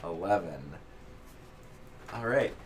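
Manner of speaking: ordinary talk, nothing marked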